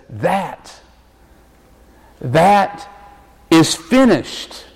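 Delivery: slow and weighty, a long pause between the words; Jesus solemnly proclaiming it finished, slow and grave; solemn slow declaration. Acted. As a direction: announce it like a final verdict. Jesus declaring the work done.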